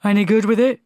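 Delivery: loud barking drill sergeant; gruff sergeant. Acted. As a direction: bark it like an old army sergeant.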